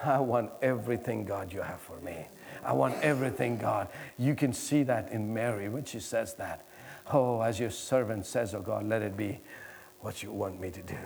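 soft, low, intimate surrender; hushed prayer. Acted. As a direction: drop to a near hush before God.

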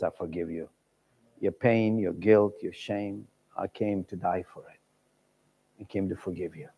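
soft, low, tender words of forgiveness; tender Jesus voice. Pretend. Jesus speaking to the listener, very soft and tender. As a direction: speak it softly, like a gentle embrace.

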